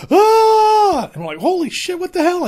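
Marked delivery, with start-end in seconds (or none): 0.1-1.1 loud, high-pitched imitated scream. Friend running out of the bushes, panicked scream. 1.4-2.4 high-pitched alarmed exclamation; startled. Retold or imitated. Friends seeing her burst from the bushes, startled. Acted reaction to screaming friend.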